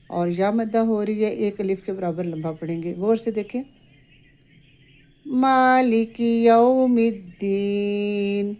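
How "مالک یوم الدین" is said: slow, drawn-out tajweed recitation; measured Quranic chant. Shown as a demonstration. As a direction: chant each word carefully. Recites verses as model.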